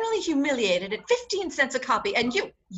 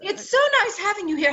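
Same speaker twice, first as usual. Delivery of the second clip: affected society hostess; 8 semitones higher, much faster than usual. Acted. [name] puts on gushing hostess act.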